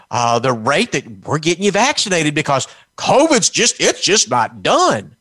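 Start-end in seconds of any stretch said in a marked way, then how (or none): none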